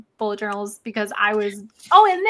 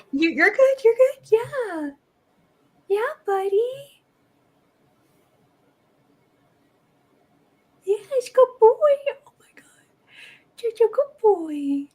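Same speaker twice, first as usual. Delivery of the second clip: much higher, squeaky pet-praise voice; 12 semitones higher, 3 dB louder, slower than usual. Real, not acted. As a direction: coo praise at a pet sitting on you. Praising the cat on her lap.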